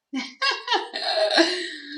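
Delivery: high burst of giggles; amused, high laugh. Real, not acted. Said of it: "I'm laughing because it's like".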